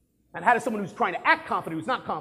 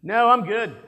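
curt refusal; 3 semitones higher, 9 dB louder, slower than usual. Retold or imitated. Guest refusing drink.

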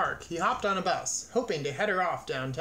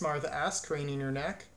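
ordinary delivery, nothing marked

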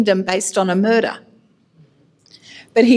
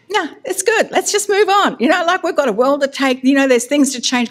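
high-pitched, hurried brush-off; mock pleading; 6 semitones higher than usual. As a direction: talk your way out of it in a high voice. Acts out dodging God.

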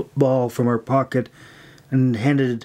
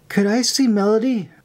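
higher-pitched, hopeful ask; timid, lifted question; tentative request; 9 semitones higher than usual. A narrator's reading. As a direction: venture it in a small high voice. Timid recruit venturing a request.

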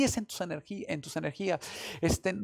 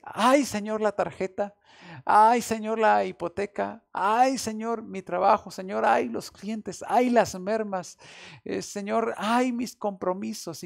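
louder, higher string of pleading sighs to the Lord; mock laments; 4 semitones higher, 5 dB louder than usual. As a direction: play the worried believer crying ay over each bill. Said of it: "Podemos decir".

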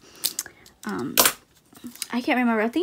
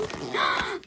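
surprised gasp; 11 semitones higher, 3 dB quieter than usual. Real, not acted. Poster surprise.

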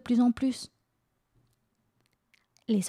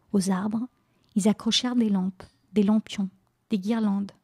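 plain reading voice

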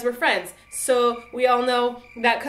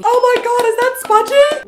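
high, loud starstruck gasping cry; fangirling squeal; 13 semitones higher, 9 dB louder than usual. Acted. Construction worker spotting Spudgy, high-pitched mock fangirling. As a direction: squeal as if spotting your idol. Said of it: "what that guy is really thinking".

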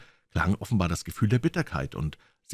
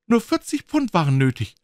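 ordinary delivery, nothing marked

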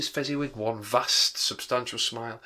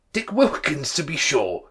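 higher, louder exclamation; delighted recognition; 4 semitones higher, 7 dB louder, slower than usual. A narrator's reading. Scrooge spotting his old friend Dick, delighted. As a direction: exclaim it as the name comes back.

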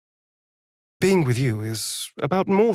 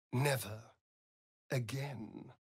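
hushed; 3 semitones lower, 14 dB quieter than usual. Acted. Final farewell, fading.

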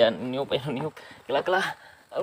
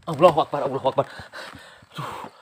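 usual speaking voice

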